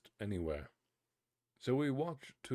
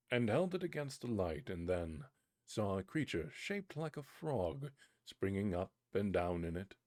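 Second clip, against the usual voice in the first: ordinary delivery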